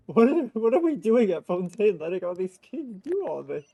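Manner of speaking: ordinary delivery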